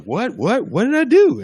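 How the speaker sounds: high, rising baffled what what; confused what. Retold or imitated. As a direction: protest innocence, pitch climbing high. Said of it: "He's like".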